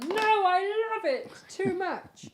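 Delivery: high, slow, drawn-out cry of "No"; hammed-up clinging to a favourite; retold with mock anguish, high and slow; mock dramatic refusal. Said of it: "I've pulled it and gone".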